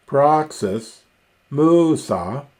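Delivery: high arching pitch on the first syllable of "Praxis" and "musa"; circumflex on penult. Shown as a demonstration. Teacher giving properispomenon examples, rise-fall on first syllable of "Praxis", "musa". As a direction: swoop up and over on "Prax" in "Praxis" and "mu" in "musa". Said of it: "has a circumflex on the penult".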